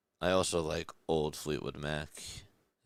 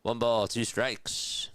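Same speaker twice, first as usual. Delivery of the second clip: slow, drawn-out, higher soothing call; relaxing voice; 6 semitones higher, 4 dB louder, much slower than usual. Shown as a demonstration. Streamer showing chat his relaxing voice, slow and soothing. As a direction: lull chat with a slow gentle count. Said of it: "This is my relaxing voice".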